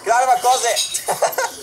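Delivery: high janitor line broken by laughter; giggling janitor yell; laughing through it. Pretend. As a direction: try to shout it but crack up.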